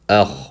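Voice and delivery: Akh ending in a held throat scrape; short a, then rasped kh in Akh; jump on kha. Shown as a demonstration. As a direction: isolate Akh, landing on the kh. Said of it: "We jump on the kha".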